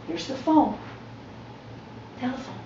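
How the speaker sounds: pitched well up, coaxing the dog; chirpy encouragement for a puppy; high, playful voice to a dog; high dog-talk voice. Real, not acted. Talking to the dog in training.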